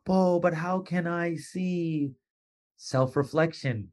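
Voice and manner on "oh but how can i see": slow, pleading mock whine; whiny excuse. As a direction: play the helpless listener asking how.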